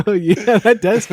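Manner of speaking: high laugh through the words; giggling while agreeing. Real, not acted.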